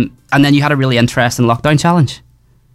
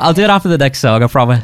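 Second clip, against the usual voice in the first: normal talking voice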